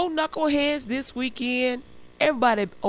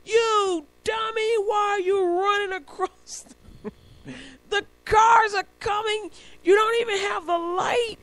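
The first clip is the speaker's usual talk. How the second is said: mock scolding; 11 semitones higher than usual. Driver yelling at a pedestrian running across without the light, mock scolding. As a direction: scold in a high, exaggerated voice. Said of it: "Then I heard myself".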